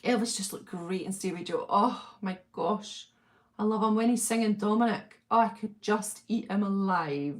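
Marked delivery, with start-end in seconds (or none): none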